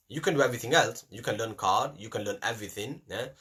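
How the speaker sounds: ordinary delivery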